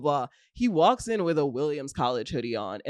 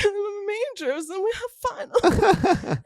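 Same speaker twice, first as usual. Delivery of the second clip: put-on much higher, slow, ditzy girlish voice; sweet cute act; slower than usual. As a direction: play the innocent airhead for the cops. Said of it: "the most charming, sweet girls".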